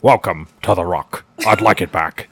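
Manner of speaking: put-on Scottish accent, dropped in pitch; Sean Connery. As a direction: demonstrate your Scottish movie-star impression. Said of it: "My Sean Connery sounds like Edgar".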